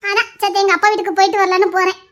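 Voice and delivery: normal talking voice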